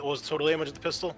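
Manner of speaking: usual speaking voice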